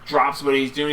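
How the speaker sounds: ordinary talk, nothing marked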